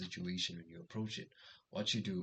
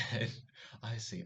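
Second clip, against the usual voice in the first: ordinary delivery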